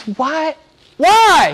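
high, loud, incredulous questioning; preacher crying out as Jesus; impassioned challenge. Acted. Jesus' challenge acted out loudly.

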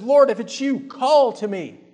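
Peter calls out high and loud, all impulse; impulsive eager cry. Acted. Peter calling across the stormy sea, loud and eager. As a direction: blurt it out, rash and eager. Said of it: "impulsive, impetuous, rash".

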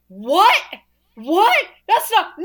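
high, loud outraged yelling; yelling in disbelief. Retold or imitated. Student yelling at the screen at Psycho's twist, shocked yelling. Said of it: "he was just yelling at the screen".

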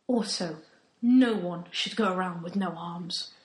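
normal talking voice

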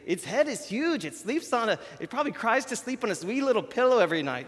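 high, quick Scottish accent impression. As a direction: put on a playful Scottish accent. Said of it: "if I could do a good Scottish, uh, accent, I'd say".

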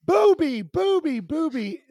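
high, loud repeated cry; imitating screaming toddler. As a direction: shriek it like a panicked small child, first loudest. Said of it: "And she starts screaming".

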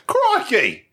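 retold surprised crikey. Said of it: "me and Jerry exclaimed".

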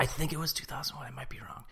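whispered, fast aside under the breath. Real, not acted. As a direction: hush it like an unsure side note. Unsure aside.

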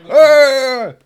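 loud high monster bellow; monster yelling. Acted. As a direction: roar it out at full volume.

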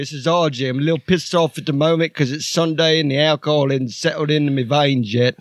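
grumpy Archie greeting the listeners; Archie character voice. Acted. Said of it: "Thanks, Archie".

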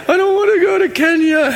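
high put-on voice of a reluctant girl; mock whiny teen girl. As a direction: squeak it out like a teen who won't go. Girl voiced, friends laughing at prophecy.

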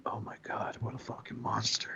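hushed, appalled whisper; whispering in mock horror at the cruelty; horrified whisper. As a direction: breathe it out in shock.

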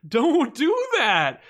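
loud, slow, drawn-out protest; horrified cry. Retold or imitated. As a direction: cry out to stop him, stretching the words. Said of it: "And you're like".

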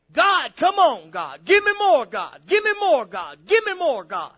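loud, high cry, dropping on each "God"; urgent pleading prayer. Acted. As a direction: beg God in insistent, chanting bursts. Acts out hungry seeker begging God.

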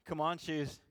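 ordinary delivery

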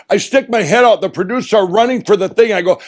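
usual speaking voice

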